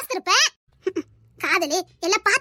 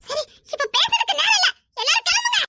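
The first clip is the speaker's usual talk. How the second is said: raised pitch, climbing higher on the order; urgent dismissal; 8 semitones higher than usual. Acted. Hurrying everyone out as there is no time, urgent.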